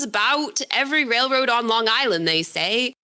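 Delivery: a lightly put-on old New Hampshire country accent, read from the book. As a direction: voice the book's old New Hampshire character in his rural accent. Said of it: "excuse my accent".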